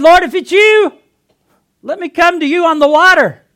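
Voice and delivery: very loud, high-pitched call; impulsive shout. Acted. Peter calling to Jesus on the waves, impulsive shout. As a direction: holler it out across the water.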